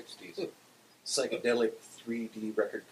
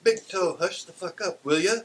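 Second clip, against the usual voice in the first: high, loud character yell; 10 semitones higher, 8 dB louder than usual. A narrator's reading. Beulah scolding Big Toe for barking, yelling high. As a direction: holler shrilly at a noisy dog. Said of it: "Beulah yells".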